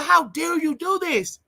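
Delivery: high, slow, hurt outrage; tearful accusation. Retold or imitated. Nephew in tears accusing his uncle, high and slow. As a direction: accuse him slowly, voice high and cracking.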